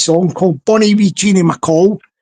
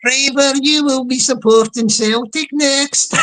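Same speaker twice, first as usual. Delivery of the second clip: higher-pitched put-on voice; Stevie Boy; 4 semitones higher than usual. Teasing a viewer about his voice, in higher mock imitation. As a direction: exaggerate the imitation of a chat regular, higher. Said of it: "what happened to your voice there?".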